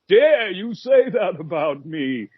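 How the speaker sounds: high, slow pompous ego voice; mock haughty ego. His ego answering the sweet audience, high, slow and pompous. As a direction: ham up the offended ego. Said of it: "blimp-sized ego going".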